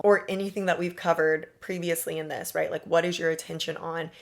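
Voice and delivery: ordinary talk, nothing marked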